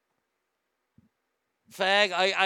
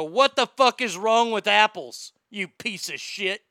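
hammed-up loud, drawn-out indignation; mock outraged reply; 5 semitones higher, 8 dB louder than usual. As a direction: lay into them slowly with mock fury. Acted irate online reply.

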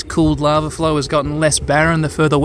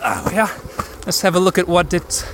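ordinary talk

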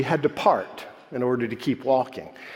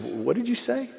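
puzzled question; 10 semitones higher, 5 dB quieter than usual. Retold or imitated. Puzzled son questions his mother.

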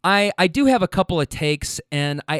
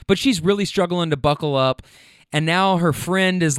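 ordinary talk, nothing marked

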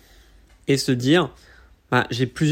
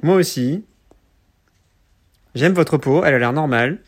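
stiff, bad robot voice; 4 dB louder than usual. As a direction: talk like a clunky android. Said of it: "avec des voix de robots nuls".

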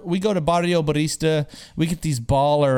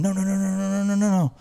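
rapid run of higher no's; retold hasty interruption; 5 semitones higher, faster than usual. Host stopping his friend from spilling, hastily interrupting. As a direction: cut him off with repeated no's.

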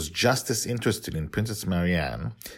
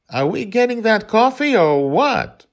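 much higher, louder, put-on princess voice; a spoiled princess cutting in, bored; impatient princess; 9 dB louder than usual. A narrator's reading. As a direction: princess interrupting the family moment, impatient. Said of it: "the princess said".

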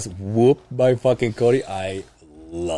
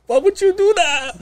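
hammed-up indignant question, much higher; mock outrage. Retelling being hooked by his buddy, in mock outrage. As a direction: playfully act betrayed. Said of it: "And I'm like, I'm a".

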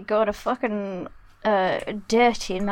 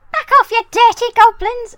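high, loud yell at the enemy; battle shout; 13 semitones higher, 11 dB louder than usual. Acted. Halfling warning off the goblins, shouting. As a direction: shout them back as a halfling. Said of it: "he says in Halfling".

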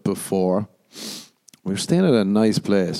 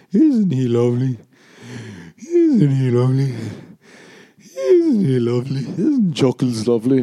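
higher, louder gushing over the dog; doting dog owner; 4 semitones higher, 3 dB louder than usual. Pretend. Owner gushing to a friend about her dog, high doting coo. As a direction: coo over an ugly pet like a proud owner. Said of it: "you gotta be like".

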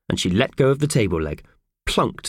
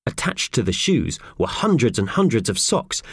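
ordinary delivery

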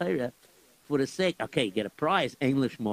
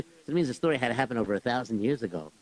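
ordinary delivery

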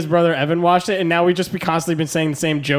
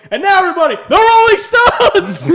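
robot announcer; 12 semitones higher, 7 dB louder than usual. Retold or imitated. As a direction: belt it like a stage announcer. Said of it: "one of the robots goes".

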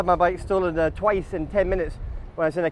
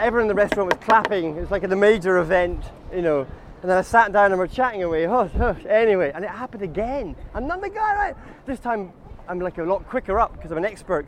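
ordinary talk

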